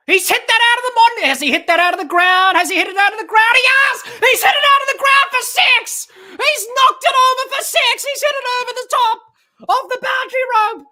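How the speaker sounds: screaming high and loud, voice cracking; ecstatic six call. Real, not acted. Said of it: "My voice is gone!".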